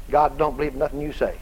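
ordinary talk

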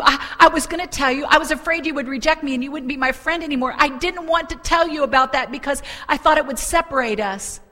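high, loud, stammering plea; horrified confession. Acted. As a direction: act out a panicked confession to your friend. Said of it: "look of horror on her face".

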